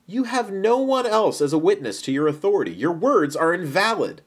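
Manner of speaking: high-pitched, contesting objection; arguing. A narrator's reading. Pharisees challenge Jesus's authority as a witness, arguing. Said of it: "The Pharisees argued".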